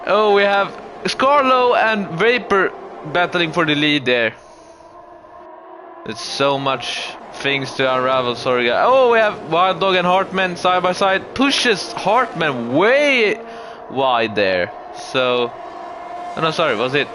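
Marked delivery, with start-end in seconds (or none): 0.1-4.3 motorsport commentator. Acted. Live race play-by-play. 8.9-14.7 motorsport commentator. Acted. Live race play-by-play.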